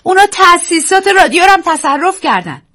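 high loud panicked cry; terrified shout. Acted. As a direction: scream the news in terror. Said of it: "وحشت زده فریاد زد".

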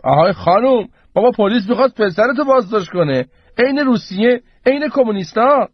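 put-on high-pitched old man's cry of protest; shouting. A narrator's reading. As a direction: call out in rising outrage. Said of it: "پیرمرد داد زد". (فریادِ اعتراضِ پیرمرد)

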